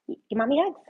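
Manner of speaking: sweet pet voice. Real, not acted. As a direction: coax the dog up high. Baby talk to dog.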